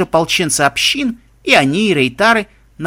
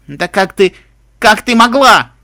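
distraught outcry building to a shout; anguished cry; 5 semitones higher, 8 dB louder, slower than usual. Acted. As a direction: cry out in shock, louder and higher. Girl finds friend seemingly dead, tearful.

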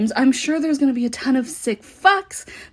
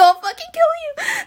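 much higher, cracking, half-crying scream; unhinged shriek. Acted. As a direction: lose control on the threat. Escalating threat during roleplay.

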